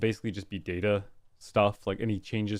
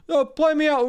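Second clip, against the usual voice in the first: calling out; 7 dB louder than usual. Acted. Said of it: "calls for outro music".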